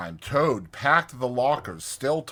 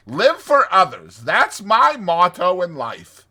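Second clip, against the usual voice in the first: high, loud, airy persuasion; diplomatic; 8 semitones higher, 9 dB louder than usual. A narrator's reading. Toad winning over his two friends, diplomatic. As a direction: make it sound grandly unselfish, swelling at the end. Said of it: "he said diplomatically".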